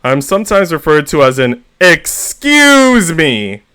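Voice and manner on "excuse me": very slow, higher, drawn-out excuse me; irritated stranger's excuse me; annoyed excuse me. Retold or imitated. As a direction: huff it out at someone in the way. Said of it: "in an annoyed tone of voice".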